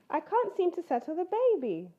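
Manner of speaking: put-on a tired mum who can't settle the baby; mother bear voice.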